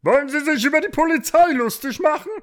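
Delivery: very high, loud indignation; loud cockerel officer; offended rooster policeman. A narrator's reading. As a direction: demand respect as an outraged rooster cop.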